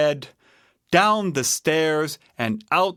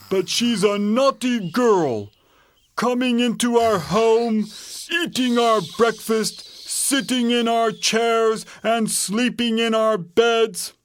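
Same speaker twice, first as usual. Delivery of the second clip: raised, indignant bear voice; Papa Bear; 9 semitones higher than usual. A narrator's reading. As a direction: answer as an exasperated papa bear. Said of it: "said Papa Bear".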